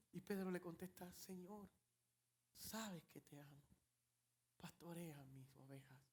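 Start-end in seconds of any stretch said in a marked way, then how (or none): 1.1-6.0 soft, low, fading to a whisper; whispered reply. Acted. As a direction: answer humbly, barely audible. Peter answers ashamed.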